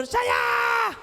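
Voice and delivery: put-on high squeaky kid's "saya"; squeaky child answer. Storyteller plays an eager child answering the trick question, high and squeaky. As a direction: answer like an eager little kid.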